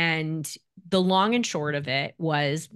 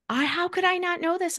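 guilty mother; 12 semitones higher than usual. Retold or imitated. Voicing guilty crying mother.

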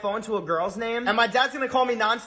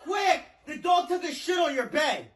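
alarmed shout; 9 semitones higher, slower than usual. Acted. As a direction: yell for grandma in panic. Calling grandma in alarm.

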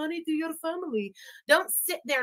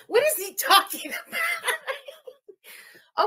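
laughing disbelief; 13 semitones higher, much slower than usual. Real, not acted.

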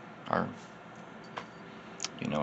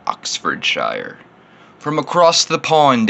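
a mock British accent for the overseas viewers; bad British accent; 7 semitones higher, 11 dB louder, slower than usual. Reviewer teasing British viewers in a mock British accent. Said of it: "insulted you a little bit with my horrible accent".

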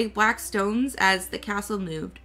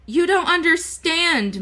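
a notch higher and louder, pleading; protesting boy; 7 semitones higher, 5 dB louder than usual. A narrator's reading. Michael protests to Sophie, urgent and raised. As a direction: stop her, it's no use.

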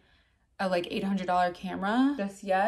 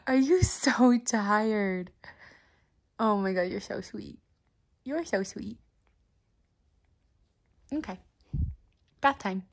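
cooing at dog. Real, not acted.

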